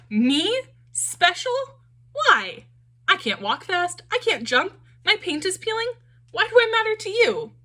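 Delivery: disbelieving, asking why he could matter; Punchinello's voice. A narrator's reading.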